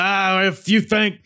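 wrestler's mic call. Retold or imitated. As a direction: whip up the crowd from the skybox. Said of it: "He's like".

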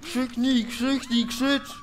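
high, loud urgent egging on; urging shout. Real, not acted. Player yelling at the game character to scream, urgent shout.

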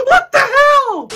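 high, loud startled shout; scared shout. Real, not acted. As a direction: yell in sudden fright. Jumpscare reaction.

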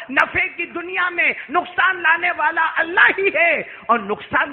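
high, sustained, ringing proclamation; impassioned sermon crescendo; full-force preaching. Acted. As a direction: declaim to the whole congregation.

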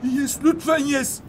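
held-back, suspenseful plea; pleading hope. Real, not acted. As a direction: beg it to work, drawing it out. Hoping the move works.

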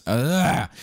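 usual speaking voice

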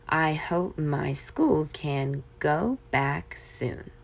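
put-on slow, gentle reading to children; story reading. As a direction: read the little story calmly. Reads a short story.

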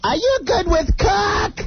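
high, slow old-lady voice mocking the mother-in-law. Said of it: "she goes".

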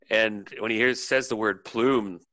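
ordinary talk, nothing marked

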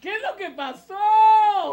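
high, loud, drawn-out question. Acted. Host asking the children what happened, loud calling question. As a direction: call it out to a whole crowd. Asks children again, much louder.

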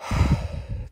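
breath out to settle down; long exhale. Pretend. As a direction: let out a long calming sigh. Calming himself after the rant.

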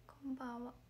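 whispered through a worn-out voice; real strained whisper after shows; hoarse voice. Real, not acted. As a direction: spare a hoarse throat with a whisper. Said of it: "声ガサガサすぎる".

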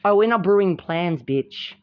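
usual speaking voice

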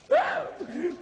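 shrill character cackle; high cackle. Acted. Griselda cackles.

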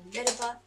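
"little bit" with "bit" said like "bot"; "bit" coming out as "baht"; odd vowel. Real, not acted. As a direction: let the vowel in "bit" open to an "ah". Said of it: "What is this little bit?".

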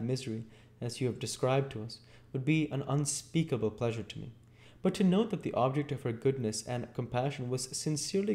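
high, gentle lady's voice; earnest young lady. Acted. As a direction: explain tenderly as a gracious lady.

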